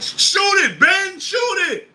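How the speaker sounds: high-pitched shouted plea, loud; imitating Kyrie yelling at his teammate to shoot. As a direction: yell it like a teammate begging him to shoot. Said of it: "Kyrie was like".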